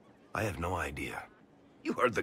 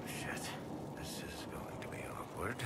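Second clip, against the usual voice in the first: much softer, breathy whisper to himself; whispered aside; 10 dB quieter than usual. Real, not acted. Varric whispering to himself before an awkward answer. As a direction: whisper it, dreading the question.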